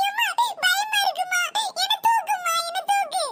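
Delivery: high, pleading scared voice; frightened child. Acted. Child begs mother to lift her, frightened pleading. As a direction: beg to be picked up in fright. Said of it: "பயப்படாதீங்க".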